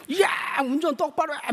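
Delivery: high-pitched mock road-rage yell; hammed-up outburst at a bad driver; imitated angry cursing. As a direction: imitate an irate driver swearing at someone. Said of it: "막 쌍욕을 했어요".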